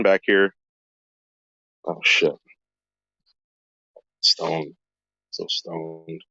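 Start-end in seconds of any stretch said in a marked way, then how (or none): 1.9-6.2 low, soft mumble; muttered aside. Real, not acted. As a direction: mutter it to yourself.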